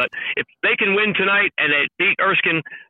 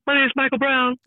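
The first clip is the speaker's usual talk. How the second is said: a hint of Michael Brown's voice; light mock player voice; deep voice impression; 7 semitones higher, 4 dB quieter than usual. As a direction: lightly imitate the player introducing himself. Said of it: "with that voice".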